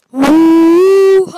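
very loud whoop; excited whoop. Real, not acted. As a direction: whoop with delight.

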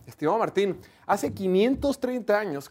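usual speaking voice

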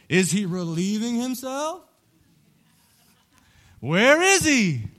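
jeering, rising to a loud high taunt; mocking taunt. Elijah mocking the prophets of Baal, jeering. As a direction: poke fun at their silent god. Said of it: "Elijah starts mocking them".

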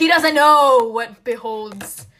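loud, drawn-out theatrical declaration; big melodramatic delivery; dramatic outburst. Acted. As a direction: proclaim it with slow, hammed-up force. Hammed-up dramatic book analysis.

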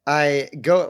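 normal talking voice

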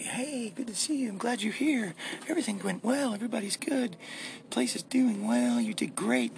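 high, very soft mock praise; imagined kindly boss. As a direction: gush softly in a tiny high voice. Imagines boss greeting him with praise.

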